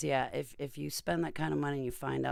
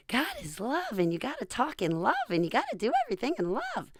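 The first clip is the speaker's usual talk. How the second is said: much higher, fast sing-song mockery; mocking Christians; 12 semitones higher, 7 dB louder than usual. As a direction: parody the sugary love preachers. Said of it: "Christians with their".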